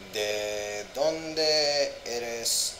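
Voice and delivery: each word stretched slow; very slow practice. Shown as a demonstration. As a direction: sound it out slowly.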